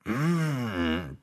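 soft satisfied mmm; contented hum. A narrator's reading. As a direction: savour it with a hum. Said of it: "промычал он".

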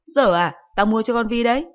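a hint of a scolding mom; mother's retort.

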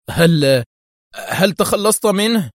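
halting, slow, high; agitated stammer. A narrator's reading. Tawfiq asks Eli, stammering excitedly. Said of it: "يغمغم في انفعال".

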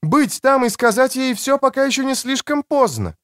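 high-pitched voice for a woman; woman's voice. A narrator's reading. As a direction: pitch it up for the female character. Woman insists to narrator.

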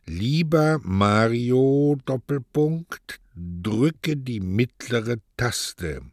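slow, halting read-aloud of the card; spelling it out. Acted. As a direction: read it out slowly like a beginner sounding out each word. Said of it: "in seiner buchstabierenden Art zu lesen".

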